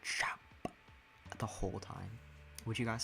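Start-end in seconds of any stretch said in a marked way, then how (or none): 0.0-0.4 whispered mock-British "chap"; horrible British accent. Streamer shows chat the joke channel, whispered British accent. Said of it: "me doing a horrible British accent".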